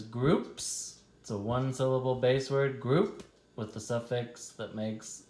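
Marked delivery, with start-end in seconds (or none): none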